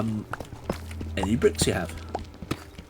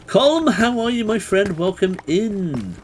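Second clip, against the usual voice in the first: warm greeting; 9 semitones higher, 4 dB louder, slower than usual. Real, not acted. Greeting a raider.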